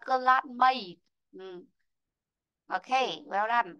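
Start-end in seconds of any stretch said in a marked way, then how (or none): 0.1-1.0 "classmate" ending in the "ay" sound, "klat-mayt"; correct pronunciation. Shown as a demonstration. Teacher explains that A is read ay, correct pronunciation. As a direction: finish on "classmate" with a clear "may" glide. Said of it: "các em sẽ đọc là".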